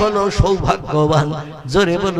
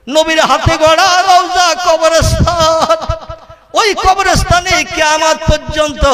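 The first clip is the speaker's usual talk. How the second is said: impassioned melodic preaching; 9 semitones higher, 5 dB louder than usual. Acted. Pilgrim begging to be buried in Medina, impassioned preaching. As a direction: plead to Allah in a soaring chant. Preacher chants plea for death.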